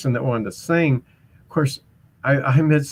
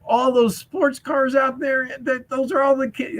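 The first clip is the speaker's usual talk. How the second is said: mock high voice; 12 semitones higher than usual. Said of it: "I go".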